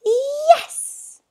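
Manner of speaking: very high, loud cheer; boy cheering free entry; exclaiming. A narrator's reading. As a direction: shout it with pure joy. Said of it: "exclaimed Bob".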